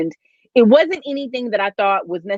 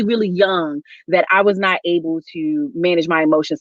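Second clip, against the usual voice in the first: ordinary delivery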